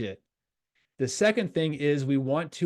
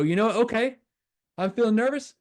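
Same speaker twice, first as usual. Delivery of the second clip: higher, tense self-talk; tensed-up bravado; 8 semitones higher, 4 dB louder than usual. Acted. Player fighting nerves before a game, tensed-up. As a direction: psych yourself up, pitch pushed up.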